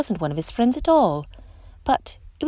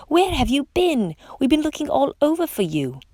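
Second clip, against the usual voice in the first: excited friends; 7 semitones higher than usual. A narrator's reading. The school greeting Stanley after searching, excited and high. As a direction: welcome him back with relief.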